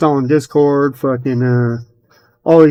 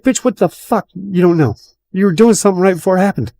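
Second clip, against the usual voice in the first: higher, fast retold rant; exasperated outburst; 6 semitones higher than usual. Yelling at her for denying she broke the PC, high and fast. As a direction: pitch up and rush the accusation.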